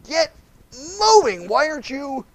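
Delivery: high, impatient mock shout; mock angry rider. Balaam urging the donkey against the wall, high mock shout.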